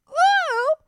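very high startled ooh; a squeaky little ghost's yelp; the little ghost startled by the princess. A narrator's reading. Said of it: "cried the little ghosts".

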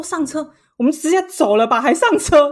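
high, loud, disbelieving comeback; incredulous retort. Retold or imitated. Retelling her reply to the stubborn travel companion, incredulous. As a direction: scoff at the idea of getting back in. Friend insists on boarding the tuk-tuk.

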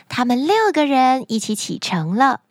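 usual speaking voice

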